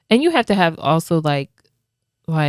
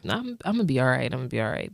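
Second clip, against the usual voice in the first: quick self-reassurance, dropping lower and softer; mock brush-off; 3 semitones lower, 5 dB quieter, much faster than usual. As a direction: rush it out as if waving off concern. Acts brushing off one's own struggle.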